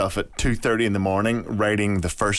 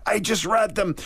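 high, gushing agent voice; mad American agent; 12 semitones higher than usual. Retold or imitated. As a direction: play an overexcited Hollywood agent. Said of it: "this mad American agent".

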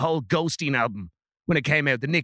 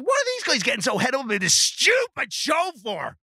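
indignant rant; 8 semitones higher than usual. Acted. Brian mocking young viewers' taste.

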